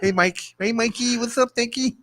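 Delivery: high lisping call to Mike; mock lisping voice. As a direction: tease him in a lisping voice. Mocking voice after lisp remark.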